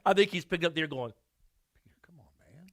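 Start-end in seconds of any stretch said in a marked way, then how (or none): 1.1-2.7 breathless whisper, let down; whispered scolding. Retold or imitated. Jesus picking up the ear, whispered scolding at Peter. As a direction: hiss it under your breath at Peter.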